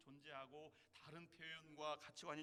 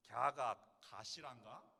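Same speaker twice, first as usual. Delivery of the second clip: slow Jeolla accent, louder; Jeolla dialect; 3 semitones lower, 12 dB louder, much slower than usual. Shown as a demonstration. Pastor demonstrating Jeolla speech to the church, in a Jeolla accent. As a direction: lean into the Jeolla lilt, slowly. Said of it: "전라도 말로 하면".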